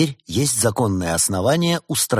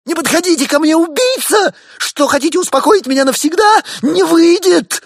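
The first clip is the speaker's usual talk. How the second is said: very high, loud terrified screech; panicked squeal; 10 dB louder than usual. A narrator's reading. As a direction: shriek in hysterical panic. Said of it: "Его голос сорвался на визг".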